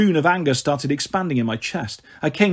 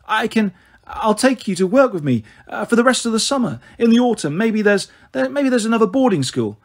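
nervous; 6 semitones higher than usual. A narrator's reading. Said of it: "She wrung her hands nervously".

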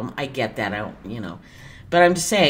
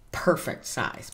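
whisper; 5 semitones lower, 8 dB quieter, slower than usual. Shown as a demonstration. Repeats the phrase as a whisper.

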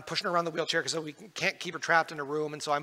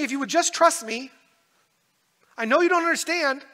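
higher, louder desperate shout; shouting at God; 11 semitones higher, 10 dB louder than usual. Retold or imitated. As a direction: hurl the words upward in anguish. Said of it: "I'm shouting at God".

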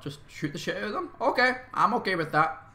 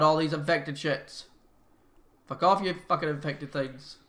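swearing tirade; 7 dB louder than usual. Real, not acted. Swearing at infected.

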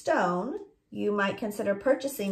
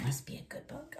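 whispered; 13 dB quieter, faster than usual. Real, not acted. Echoing child quietly.

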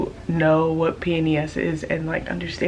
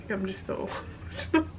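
teary laugh; 10 dB quieter, much faster than usual. Real, not acted. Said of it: "I'm so emotional".

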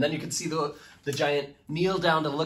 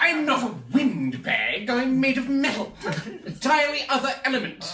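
high, booming posh British sword; posh British accent; 12 semitones higher, 6 dB louder than usual. Acted. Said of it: "says the sword".

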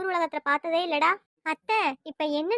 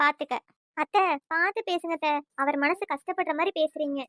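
ordinary talk, nothing marked

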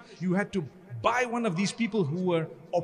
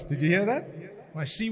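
ordinary delivery

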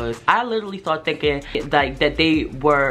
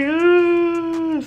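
long high loud wail; mock panicked wail; 5 dB louder than usual. Kid panicking as the edible is revealed, long high wail. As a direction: hold a high cry of dismay.

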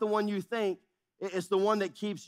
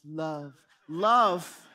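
put-on film wedding voice, swelling louder; Princess Bride voice; 4 dB louder than usual. Shown as a demonstration. Pastor imitating the film at a wedding, Princess Bride voice. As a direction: intone it, then boom the second one. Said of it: "say it in my Princess Bride voice".

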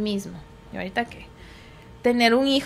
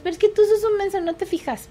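put-on higher, fast kid's taunt; snippy child; 8 semitones higher than usual. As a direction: tease the brother like a kid. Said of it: "así van a ser". (niño burlón, más agudo y rápido)

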